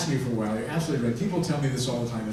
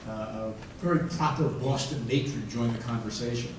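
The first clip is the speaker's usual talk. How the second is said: usual speaking voice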